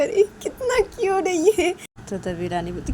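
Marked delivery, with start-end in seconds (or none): 0.0-1.7 put-on gushing over baby. Siya gushes over baby.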